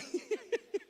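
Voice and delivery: high-pitched laugh. Real, not acted. Pastor laughing at the hunger punchline, high giggle.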